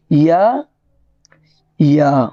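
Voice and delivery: ordinary talk, nothing marked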